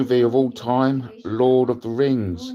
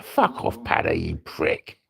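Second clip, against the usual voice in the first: whispered, much softer insult; whispered retort; 4 semitones lower, 6 dB quieter than usual. Retold or imitated. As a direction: hiss it under your breath at your mate. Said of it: "I was like".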